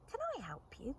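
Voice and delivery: small croaky frog. A narrator's reading. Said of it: "croaked a voice".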